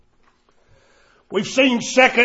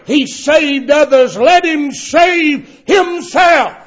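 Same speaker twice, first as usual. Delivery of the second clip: loud, higher, slow jeering; mocking rulers; 6 semitones higher, 7 dB louder, slower than usual. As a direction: sneer the words out to ridicule. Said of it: "or mocked him".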